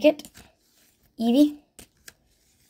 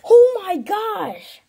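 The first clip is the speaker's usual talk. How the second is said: long, drawn-out high exclamation; overjoyed cry; 11 semitones higher, 5 dB louder, much slower than usual. Real, not acted. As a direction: cry out slowly and loudly, amazed. Still stunned by favourite card.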